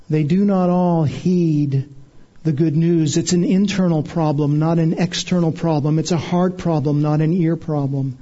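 ordinary talk, nothing marked

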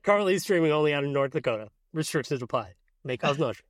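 rattled off like ad small print; quick mock fine-print read; fast legal disclaimer. Mock disclaimer after show outro.

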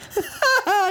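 high burst of laughter acting out losing it; re-enacted laughing fit. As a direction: crack up loudly, high-pitched, as you did on set. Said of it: "And you can just hear me".

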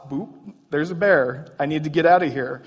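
quick, loud, jumpy inner voice; a startled rush of thought; mock panic. Voicing panicked thoughts at bear.

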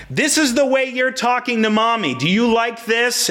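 high, scolding dad mimicking his son; mimicked screaming tone. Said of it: "I responded with a similar tone of voice".

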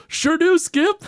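mock keen player; eager player. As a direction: chipper yes-man answer. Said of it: "Daryl Strawberry go".

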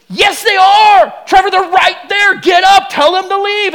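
imitated high, shouted insistence; upset wife. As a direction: play the wife yelling frantic orders at her husband. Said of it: "she gets u-, upset with me".

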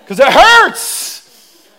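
slow, high, drawn-out whine; mock whimper. Explaining the tears to the church, slow hammed whimper. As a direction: stretch it like a hurt child. Wife plucking his eyebrows.

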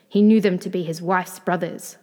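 ordinary delivery

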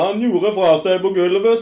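loud, high officer's proclamation; stern officer announcement. Retold or imitated. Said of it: "oberstløytnant går opp i retter og bare".

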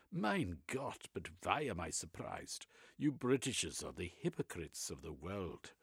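put-on Reich in a German accent, eagerly expounding.

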